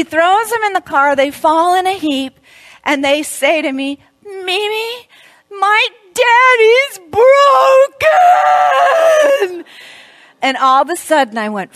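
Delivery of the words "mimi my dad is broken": put-on high, small child's voice, slowed; high childlike voice, slow and halting. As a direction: voice the grieving little grandkid. Grandchild voiced in story.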